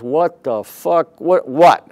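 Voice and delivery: loud, high, alarmed what the; startled outburst. Retold or imitated. Manager hears bad news from the detective, loud startled alarm.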